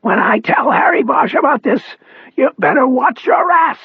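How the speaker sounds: high, straining raspy threat; strong rasp. A narrator's reading. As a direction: threaten them, pushing through the rasp. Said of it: "his voice the clearest and strongest".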